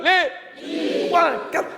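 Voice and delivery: high, lightly shouted count-in. Acted. Leader calling the count-in for the troop, high call. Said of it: "Après les E".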